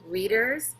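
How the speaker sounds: plain reading voice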